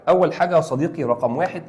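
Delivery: ordinary delivery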